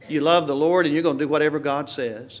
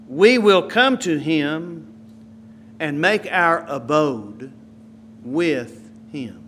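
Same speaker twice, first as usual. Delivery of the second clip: slow, fading reverent reading; slower than usual. Acted. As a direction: savour each word.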